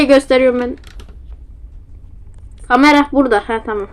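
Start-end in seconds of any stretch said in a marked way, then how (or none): none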